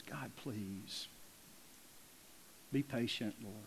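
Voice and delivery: very soft, low, begging prayer; humble plea. Acted. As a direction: beg quietly, as if face down before God. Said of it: "He falls on his face".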